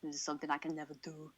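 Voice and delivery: a hint of a low robotic film voice; lightly voiced movie robot. Quoting the end of the movie, lightly robotic. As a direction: quote the film line, flat and mechanical. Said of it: "when he goes".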